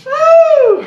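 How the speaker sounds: loud high hoot of triumph; high whoop. Real, not acted. Coach whoops after set.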